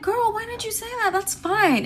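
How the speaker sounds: high, light, quick to agree; over-sweet reassurance. Retold or imitated. Friend offering to stay, high-pitched reassurance. Relieved answer to friend in retelling.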